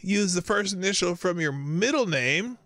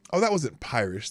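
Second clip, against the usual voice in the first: ordinary talk, nothing marked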